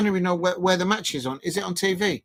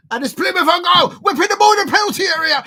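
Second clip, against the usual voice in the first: high, loud, rushed play-by-play; excited commentary; 9 semitones higher, 8 dB louder, faster than usual. Real, not acted. Fan watches ball whipped into penalty area, excited shout. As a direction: shout the attack as it happens. Live call of a dangerous attack.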